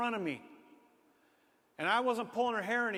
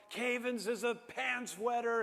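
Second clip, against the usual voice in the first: slow, higher schoolyard jeer; mock taunt; 5 semitones higher, slower than usual. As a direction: imitate kids chanting a tease. Imitating classmates' taunting chant.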